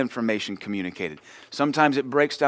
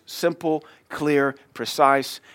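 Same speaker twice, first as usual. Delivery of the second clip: slow emphasis; 3 semitones higher, much slower than usual. Real, not acted. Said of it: "preaching emphasis".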